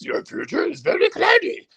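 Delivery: very high, put-on Jedi master voice; Yoda. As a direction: squeeze it way up into a comic sage impersonation. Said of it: "that's basically Yoda's way of saying".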